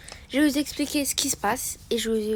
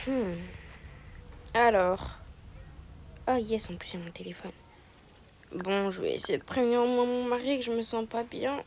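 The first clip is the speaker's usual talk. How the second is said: usual speaking voice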